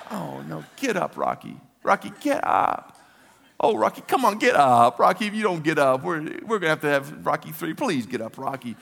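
high, urgent plea to a fallen boxer; desperate pleading. Retold or imitated. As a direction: beg him to get up, voice climbing then easing.

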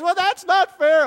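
put-on a kid protesting loudly that it's not fair. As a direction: blurt it out like an indignant child. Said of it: "when one of the kids says".

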